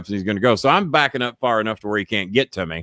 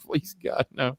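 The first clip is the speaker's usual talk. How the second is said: soft whispered mock prayer; hushed mock plea; 9 dB quieter, slower than usual. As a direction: whisper it, dreading. Dreading Funk again.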